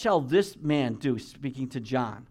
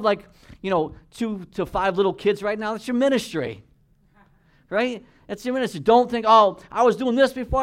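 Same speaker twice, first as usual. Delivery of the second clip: raised, louder and higher, pressing the point home; preaching at full force; 6 semitones higher, 6 dB louder than usual. Real, not acted. Preacher exhorting the congregation not to compare, forceful and loud.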